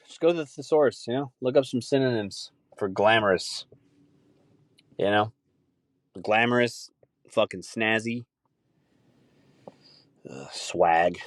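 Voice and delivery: normal talking voice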